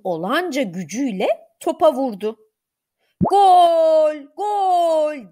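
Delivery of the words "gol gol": high, drawn-out cry of "goal"; shouted goal cheer. A narrator's reading. Orkun has just kicked the ball, shouting in celebration. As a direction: call it out long, first one louder. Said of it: "diye bağırdı".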